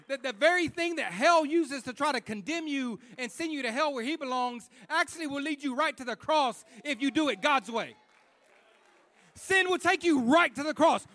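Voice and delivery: loud, high, driving sermon voice; preaching at full force. Acted. Preacher urging the congregation about sin, in full-force preaching. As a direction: preach it out hard to the room.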